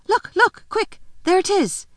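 high, excited cry of discovery; urgent excitement. A narrator's reading. Child alerts the others to the animal, high urgent excitement. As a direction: point it out urgently, pitched right up.

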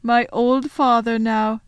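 loud, slow lament; mournfully voiced faun; ashamed faun. A narrator's reading. Faun confesses with shame.

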